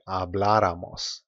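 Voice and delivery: habláramos stressed on BLA, ha-BLA-ra-mos; stress on accented A. Shown as a demonstration. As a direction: model habláramos with the weight on the second syllable. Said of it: "where that little accent mark appears".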